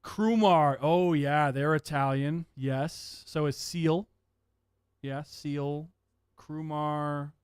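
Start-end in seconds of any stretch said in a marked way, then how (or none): none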